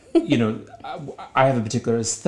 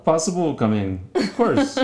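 put-on Indian accent; 5 semitones higher than usual. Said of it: "that Indian accent".

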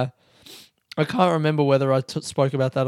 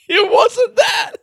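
high, loud protest cracking into laughter; squealed, embarrassed self-defence; laughing protest; 7 semitones higher, 5 dB louder than usual. Real, not acted. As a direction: insist while losing it laughing. Laughing protest, then breaks up laughing.

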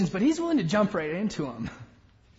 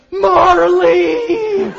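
loud, high, hammed-up tearful cry; girl's mock wail; 7 semitones higher, 9 dB louder than usual. Girl crying out in a packed theater, mock wail. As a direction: wail the name like a heartbroken girl. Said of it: "I heard a girl a few rows back".

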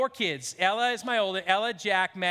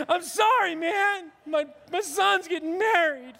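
replaying sobbing to the stranger; mock tearful apology; 7 semitones higher than usual. Father in the steam room, sobbing to a stranger. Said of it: "I'm just crying through it".

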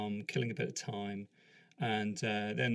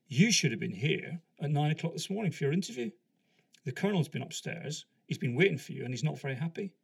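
raised, higher voice of reproach; scolding sergeant; 5 semitones higher, 6 dB louder, faster than usual. Retold or imitated. Sergeant to late interviewee, scolding. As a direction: tell off a latecomer, pushing the key words.

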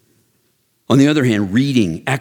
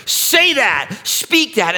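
God directing; 13 semitones higher, much slower than usual. Retold or imitated. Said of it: "he used me and said".

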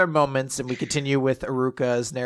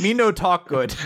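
mock broken English; 5 semitones higher, 4 dB louder than usual. Joking about failing the English exam, in mock broken English. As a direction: play dumb in bad English. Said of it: "you'd fail the English exam".

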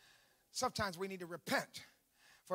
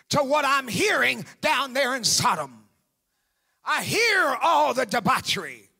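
loud, high revival preaching with punched phrase ends; full-force preaching; 8 semitones higher, 14 dB louder than usual. Real, not acted. Preacher calling church to hear the cry, full-force preaching. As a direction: build to an urgent shouted cry to the church.

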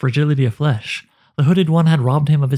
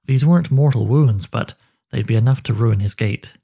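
usual speaking voice

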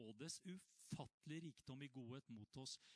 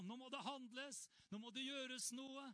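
high urgent cry; 10 semitones higher than usual. Retold or imitated. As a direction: shout that it must be done now. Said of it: "alle roper".

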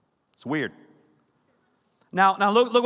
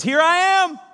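proclaiming; 7 semitones higher, 4 dB louder than usual. Retold or imitated. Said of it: "he says".

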